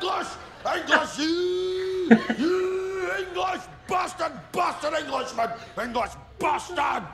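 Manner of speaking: high, lowing Scottish bellow; cow with a cough. Acted. Comic mimicking a type of Scotsman, cow-with-a-cough voice. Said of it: "the Scotsman who sounds like a cow with a cough".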